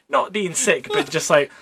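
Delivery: ordinary delivery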